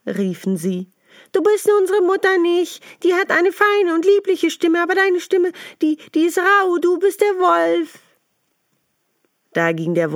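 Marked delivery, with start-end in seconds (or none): 1.3-8.0 put-on much higher, louder kid voices refusing; little goats shouting no through the door; defiant goat kids. Kids refusing to open for the wolf, high and loud.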